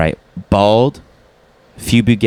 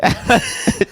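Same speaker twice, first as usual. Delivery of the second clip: laughing; 3 dB quieter than usual. Real, not acted. End of laughing line.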